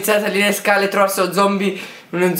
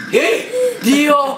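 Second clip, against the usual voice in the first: real high-pitched fright shout; startled yell; 10 semitones higher, 4 dB louder than usual. Real, not acted. Player jumped by zombies in the game, startled yell. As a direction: yelp high in sudden shock.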